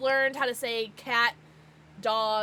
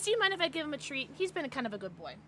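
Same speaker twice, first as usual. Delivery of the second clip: ordinary talk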